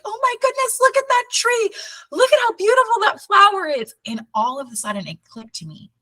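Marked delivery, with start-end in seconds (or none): 0.0-3.9 very high-pitched, giddy mock awe; high, breathless-with-delight reenactment; gushing wonder. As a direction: imitate yourself marvelling in a high squeal. Retelling her mushroom-trip wonder at nature.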